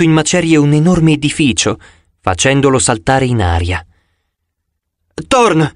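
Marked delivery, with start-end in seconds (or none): 5.3-5.7 raised call into the fog. A narrator's reading. Annabeth searching the dark hill, calling out. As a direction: call a lost friend's name across a hill. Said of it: "gridò Annabeth".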